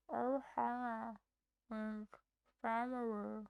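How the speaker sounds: slow, whispered alien rasp; whispered Stitch attempt. Shown as a demonstration. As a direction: breathe it out like Stitch. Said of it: "Thoughts on my attempt?".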